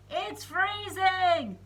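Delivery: very high, loud, slow shout; loud high kid yell. Acted. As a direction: yell it into the stethoscope. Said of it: "shouted into it".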